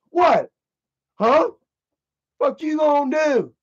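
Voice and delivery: high, punchy dare; challenging. Retold or imitated. Heckler staring back at the boyfriend, challenging. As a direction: pitch it up and goad him.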